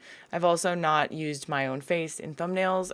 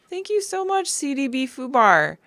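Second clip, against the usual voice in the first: excited thanks; 7 semitones higher, 3 dB louder than usual. Real, not acted. Thanking a commenter warmly.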